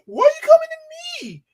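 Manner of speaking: put-on high, loud whiny child voice; mocking a congressman as a whining child; petulant child. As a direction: whine it like a kid dodging blame. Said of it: "he's like a petulant child".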